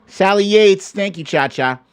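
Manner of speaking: usual speaking voice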